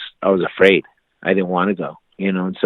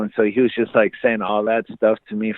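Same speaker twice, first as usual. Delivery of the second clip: ordinary talk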